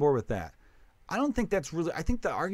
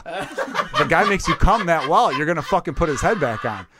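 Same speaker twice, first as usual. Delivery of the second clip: laughing; 4 semitones higher, 6 dB louder than usual. Real, not acted. Laughing through the punchline.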